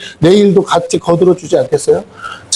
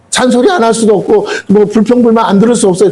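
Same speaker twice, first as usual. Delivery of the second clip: usual speaking voice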